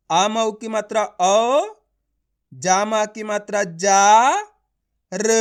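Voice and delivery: औ, जा, र drawn out with long gaps; letter by letter. Shown as a demonstration. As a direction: spell slowly, stretching औ, जा and र separately. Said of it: "एक एक अक्षर का उच्चारण".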